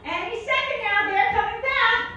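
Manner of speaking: a mock innocent child; hopeful. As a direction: say it like a trusting kid. Hopeful kid thoughts.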